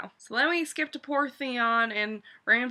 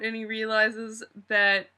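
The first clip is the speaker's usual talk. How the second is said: normal talking voice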